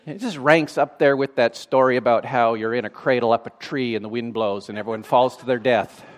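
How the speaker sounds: ordinary talk, nothing marked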